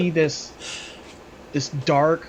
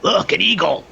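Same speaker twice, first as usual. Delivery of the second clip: sudden louder, higher call-out; mock alert; 4 semitones higher, 7 dB louder than usual. Game master's character tricking a player, mock alert. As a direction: call it out as a distraction.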